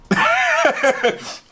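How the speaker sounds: a short high laugh at himself; rueful laugh. Real, not acted. Laughing at himself over the roller coasters, a short high laugh.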